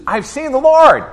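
high, loud, breathless news; excited announcement. Acted. Mary telling the disciples she saw the Lord, excited. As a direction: burst in with the news.

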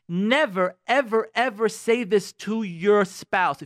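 "Never, ever, ever" hammered out slowly, high and loud; strong admonition. Real, not acted. Warning couples what never to say, "Never, ever, ever" strongly stressed. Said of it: "I'm sorry for being so strong".

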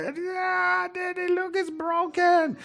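put-on high, small child's voice, soft and teary; high, hushed child whimpering that her toy is broken; little daughter crying. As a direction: play the tearful little girl holding her broken doll. Crying daughter shows broken doll.

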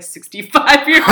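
bursting into laughter on the punchline; laughing. Real, not acted. Retelling doctor's remark, laughing.

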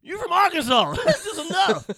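very high, loud yell through laughter; mock hysterics, shrieked and laughing; hammed-up hysterical fan. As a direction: holler it while cracking up. Fan cracks up at one line.

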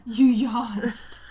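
laughing out the words in shock; delighted laughing accusation; laughing disbelief. Real, not acted.

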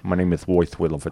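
imitating a lisp, "Royce" with th for s; quick lisped "Royce Williford", as if without teeth; toothless lisp. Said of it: "He had had a lisp".

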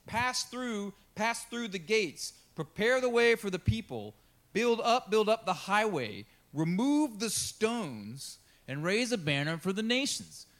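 high, loud, drawn-out proclamation; scripture reading. Acted. Pastor reading Isaiah sixty-two as a herald's call.

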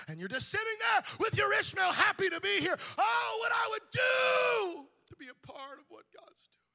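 high, loud preacher's cry, near breaking; preaching at full force. Acted. Pastor crying out to the church, full preaching force. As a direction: shout it out to the congregation.

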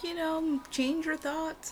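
high, slow sing-song mockery; mocking quote. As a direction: parrot the advice in a high, drawn-out voice. Mocking what people tell her.

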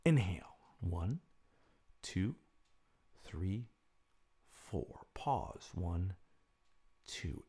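soft, slow counting of breaths; guided breathing count. Acted. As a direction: count the breaths calmly and softly.

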